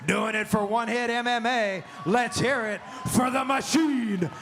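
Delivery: stretched ring announcer intro; booming fighting game announcer. Acted. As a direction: drag out the fighter's name.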